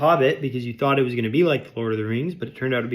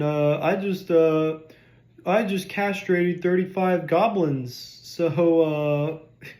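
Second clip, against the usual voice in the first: hammed-up boastful brute, a bit higher; mock dumb warrior; 5 semitones higher, slower than usual. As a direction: parody a bragging fighter. Mocking a dumb movie joke.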